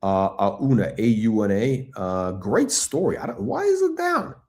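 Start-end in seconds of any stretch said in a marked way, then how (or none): 3.2-4.3 baffled. Real, not acted. His stock unexpectedly down.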